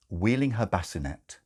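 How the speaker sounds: plain reading voice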